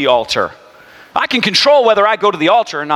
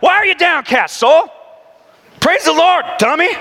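scolding his soul; 8 semitones higher than usual. Retold or imitated. Preacher scolding his downcast soul, high mock shout. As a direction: bark at your own soul. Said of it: "then I'm like".

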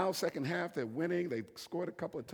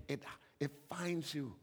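ordinary delivery

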